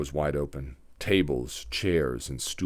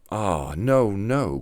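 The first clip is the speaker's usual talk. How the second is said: a mild, slightly raised cry of alarm; little goslings protesting, a touch higher; 3 semitones higher, much slower than usual. A narrator's reading. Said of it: "cried the little goslings".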